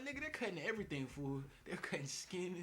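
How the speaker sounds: high, loud, fast complaint; panicked protest. Retold or imitated. Customer protesting to the barber about sharp edges, loud and fast. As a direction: blurt it in pain. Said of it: "I'm like".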